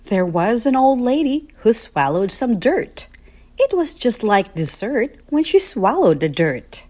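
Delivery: ordinary delivery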